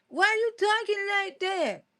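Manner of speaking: high, slow mock of a bully; teasing bully. Bullies questioning her proper talk, slow high teasing. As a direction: drag out the imitated taunt up high. Repeats the bullies' taunt after laughing.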